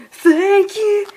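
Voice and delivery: high, loud gasping thanks; high loud overacted gratitude; loud character voice. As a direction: yell the thanks in ecstasy. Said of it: "he's getting a little loud".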